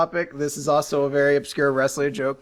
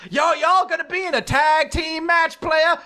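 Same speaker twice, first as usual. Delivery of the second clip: Teddy Long; 11 semitones higher than usual. Acted. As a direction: announce it like a hyped match-maker. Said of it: "when Teddy Long comes in, he's like".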